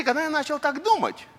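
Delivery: ordinary talk, nothing marked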